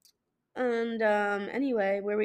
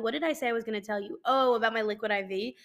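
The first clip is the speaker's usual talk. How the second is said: ordinary delivery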